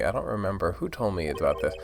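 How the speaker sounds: ordinary delivery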